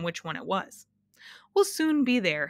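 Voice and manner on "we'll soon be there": happy announcement. A narrator's reading. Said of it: "he announced happily".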